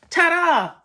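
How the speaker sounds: bright finishing ta-da for the viewers; ta-da reveal. Real, not acted. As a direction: present it with a flourish.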